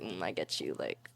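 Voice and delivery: low, quiet knowing murmur; knowing acted aside. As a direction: drop low and dry, like you get them. Acts out bonding over sarcasm.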